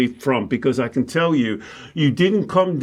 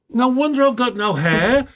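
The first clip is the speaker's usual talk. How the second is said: 1 exasperated inner thought; 7 semitones higher than usual. Retold or imitated. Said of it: "I thought".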